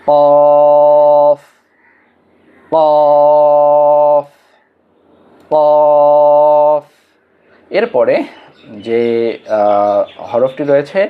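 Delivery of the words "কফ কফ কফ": কফ loud, the vowel held long and level; drawn-out recitation. Shown as a demonstration. Teacher leading learners in reading the letter, কফ loud and drawn out. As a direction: project কফ as one long, even note. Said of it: "আমরা পড়ি".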